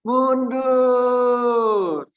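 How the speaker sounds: long, loud, high-pitched singsong call; Solo parking attendant. Acted. Preacher as slow Solo parking attendant, drawn-out call. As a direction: stretch the word out endlessly while calling. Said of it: "orang Solo jadi tukang parkir".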